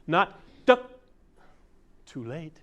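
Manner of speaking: high, loud drawn-out "duck"; mock slow reaction. Shown as a demonstration. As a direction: play the too-late shortstop. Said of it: "Instantaneous movement".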